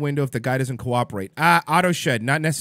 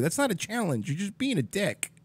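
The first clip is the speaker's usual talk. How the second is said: normal talking voice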